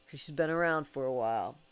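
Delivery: ordinary talk, nothing marked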